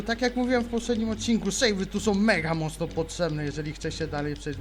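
ordinary talk, nothing marked

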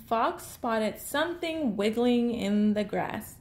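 plain reading voice

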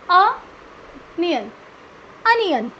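Onion broken up, "uh - nyun", then whole; correct pronunciation. Shown as a demonstration. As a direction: say Onion with an open "uh" first. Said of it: "आज के बाद क्या pronounce". (Onion को "अ-नियन" बोलें)

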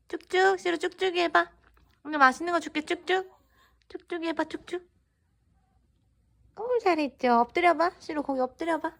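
high, bright dog-training voice; talking to a dog. Real, not acted. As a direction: coax the dog into a trick. Owner giving the dog commands.

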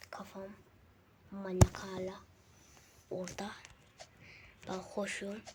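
soft whisper to keep quiet. Real, not acted. Boy whispering to viewers while mom sleeps, whisper. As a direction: whisper so mom stays asleep. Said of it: "Sessiz olmalıyım".

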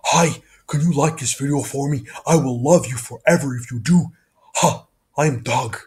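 slow, eager talking dog voice; an adoring cartoon dog begging to be friends; Doug the dog. Shown as a demonstration. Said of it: "I'll do one more time".

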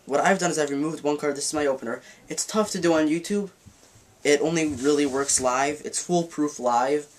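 normal talking voice

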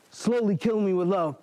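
ordinary talk

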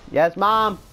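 dutiful holler to Mom; calling to Mom. Real, not acted. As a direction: call back to another room. Answers parent in another room.

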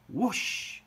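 long, airy whoosh; whooshing wind. A narrator's reading. Reader voicing the wind and rain, whooshing. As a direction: swoosh like a gust of wind.

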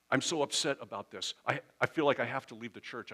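hammed-up upset outburst; all upset. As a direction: act out an overwrought late-night caller. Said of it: "if you call late at night all upset, and your voice is quivering".

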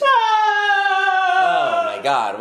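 long, very high drawn-out shriek; a thin, stretched, mouse-like yelp; high feminine cry. Real, not acted. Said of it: "what a feminine cry".

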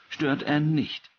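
ordinary delivery

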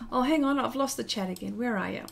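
ordinary talk

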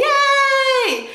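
high, exaggerated mock celebration, loud; fake-cheer each yay; sarcastic cheer. Reviewer mocking the play's success with a mock cheer.

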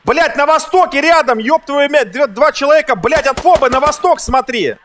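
high, loud frantic callout; frantic shouting. Real, not acted. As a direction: yell the warning in a panic. Panicked callout under fire.

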